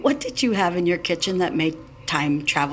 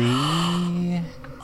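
astonished gasp; 4 semitones lower than usual. Acted. Time travel reveals the Ice Age.

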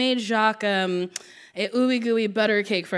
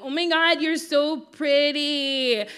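put-on higher, slow, sugary kid compliment; imitating herself as a kid; 5 semitones higher, slower than usual. Little girl leaning over mom's lap flattering the worker, higher and slow. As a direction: lay it on thick like a child angling for a toy.

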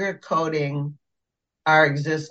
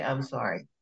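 small, soft, lower-pitched sorry; meek little retold apology, much softer; 4 semitones lower, 9 dB quieter than usual. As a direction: apologize under your breath, reflexively. Her own reflexive apology, acted.

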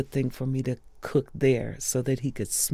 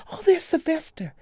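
much higher, louder delighted cry; crowd's excited call; 4 dB louder than usual. Retold or imitated. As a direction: call out in surprise at seeing him. Crowd calling out.

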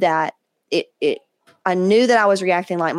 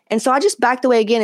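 ordinary talk

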